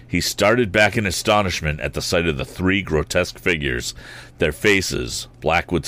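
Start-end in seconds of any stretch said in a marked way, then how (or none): none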